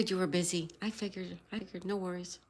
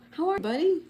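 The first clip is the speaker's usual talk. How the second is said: much higher, louder "buddy" greeting; excited greeting; 10 semitones higher, 6 dB louder, faster than usual. Real, not acted. Streamer greets Billiard Bago in chat, high excited hello. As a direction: greet a pal with delight.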